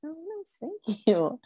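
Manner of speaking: high, slow, soft and moved; touched thanks. Real, not acted. Val thanking a friend for kind words, high and soft. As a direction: let the compliment catch you off guard.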